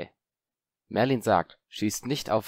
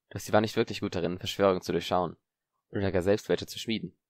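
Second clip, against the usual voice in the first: ordinary delivery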